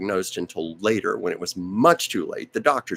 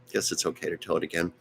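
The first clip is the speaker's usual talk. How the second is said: ordinary talk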